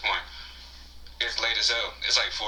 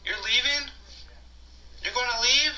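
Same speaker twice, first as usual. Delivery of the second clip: put-on much higher, plaintive girlfriend voice; 14 semitones higher, 4 dB quieter than usual. The date asks him why he is leaving, in a high plaintive voice. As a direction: plead it like the hurt date. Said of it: "And she was like".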